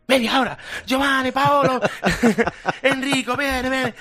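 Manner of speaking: high, loud hammed-up Italian tailor calling out names; mock Italian bustle, shouting for the tailors; excited Italian tailor. Tailor rallies his Italian staff in a hammed-up Italian rush. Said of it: "Como son los italianos".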